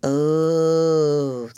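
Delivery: long drawn-out moan of sympathy. Acted. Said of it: "they all moaned".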